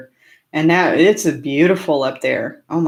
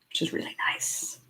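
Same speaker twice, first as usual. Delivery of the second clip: whisper; 14 dB quieter than usual. Real, not acted. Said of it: "whispered aside".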